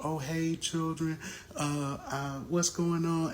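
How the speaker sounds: lightly mock the mother's sweet phone voice; mother's fake-sweet voice. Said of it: "now she's going on with the children".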